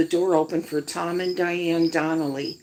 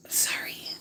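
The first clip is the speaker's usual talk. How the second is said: whisper; 8 dB quieter than usual. Real, not acted. Aside to live audience.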